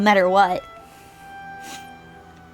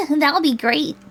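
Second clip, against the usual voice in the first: voiced girl; 6 semitones higher, 3 dB louder, slower than usual. Voicing Julie's pleased reply.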